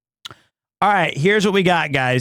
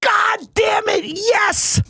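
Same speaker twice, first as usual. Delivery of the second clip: high, full shout of victory; triumphant yell; slower than usual. Retold or imitated. Streamer acting out the bowler's winning yell, shouting. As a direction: scream it like the winning strike. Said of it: "first he yelled".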